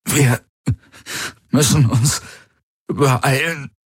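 high, halting, labored speech; fighting for every word. A narrator's reading. Cramping man urges friends to hurry, fighting for words. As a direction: force out each word between gasps. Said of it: "als würde er um jedes Wort kämpfen".

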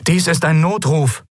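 high, strained voice through static; stranger's voice breaking through noise; crackling distress call. A narrator's reading. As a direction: call for help over a bad radio. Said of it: "rauschte unerwartet eine fremde Stimme".

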